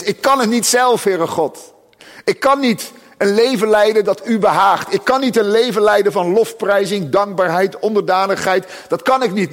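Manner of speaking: a lightly voiced desperate prayer, high and loud; pleading believer. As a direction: cry out your inability to God. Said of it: "Dat is". (smekende gelovige, hoog en luid)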